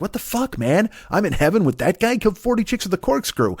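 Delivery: high, strained disbelief, held back in volume; mock incredulous complaint. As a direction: complain in disbelief, pitch jumping up. Pope's disbelief at Bundy's welcome.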